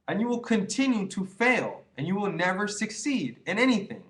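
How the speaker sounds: high, pressing warning with weight on never; stern warning. Real, not acted. Lecturing the viewer on never succeeding, stern and high. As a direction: hammer home the consequence.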